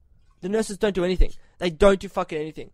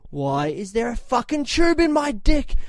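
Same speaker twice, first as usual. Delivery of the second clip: angry; 5 semitones higher, slower than usual. Retold or imitated. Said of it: "I got so angry".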